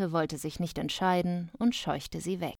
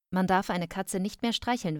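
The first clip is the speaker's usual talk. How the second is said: ordinary delivery, nothing marked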